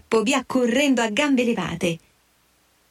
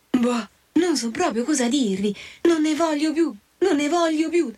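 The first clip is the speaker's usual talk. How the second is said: small, higher voice, stammering at the end; dazed little creature struggling to explain; feeble little voice; 5 semitones higher than usual. A narrator's reading. Dazed Bill tells his friends what happened, feebly. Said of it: "un vocino fievole".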